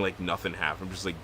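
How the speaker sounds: normal talking voice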